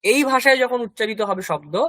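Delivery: normal talking voice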